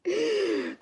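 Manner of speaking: high, soft real laugh. Real, not acted. Laughing at Trina's age mistake, high soft laugh. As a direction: let a laugh slip out high.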